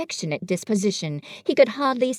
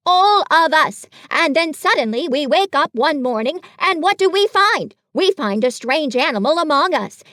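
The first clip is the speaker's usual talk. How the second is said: higher, louder fussy Rabbit voice; bossy Rabbit; 5 semitones higher, 6 dB louder than usual. Acted. Rabbit lecturing Pooh and Piglet, fussy and loud. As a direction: Rabbit laying out the problem with fuss.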